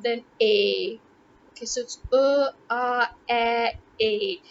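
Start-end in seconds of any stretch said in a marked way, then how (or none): 2.1-4.4 "a" stepped through uh, ah, flat a, ay; four sounds of A. Shown as a demonstration. Showing viewers every pronunciation of letter A, demonstrated one by one. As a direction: draw out each "a" as a separate vowel. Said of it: "these are all the different pronunciations".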